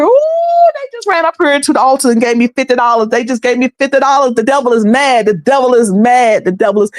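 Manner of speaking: mock televangelist gloating about donations; mocking preacher. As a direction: ham up a greedy preacher crowing over cash. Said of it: "I bet somebody won't go up here".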